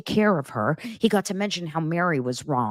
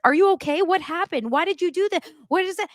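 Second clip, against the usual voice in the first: high mock nervous questions, Cody fussing; 12 semitones higher than usual. Vlogger mimics Cody fussing over Robin, high and nervous. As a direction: pitch up high, mock worried husband. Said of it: "he's like".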